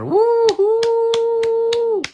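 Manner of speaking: high, drawn-out whoop; loud falsetto woohoo; celebratory woohoo. Real, not acted. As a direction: cheer in a long high hoot. Cheers the elevator announcement.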